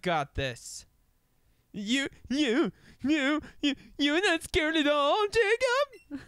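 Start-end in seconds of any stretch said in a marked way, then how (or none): 1.7-6.2 mock game character. Voicing game character's lines.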